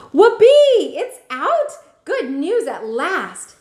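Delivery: high, loud whoop of relief; joyful shout. Acted. Grandma on the phone, shouting with joy. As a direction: grandmother whooping at good news. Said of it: "Grandma shouted".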